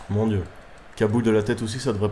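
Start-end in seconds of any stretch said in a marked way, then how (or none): none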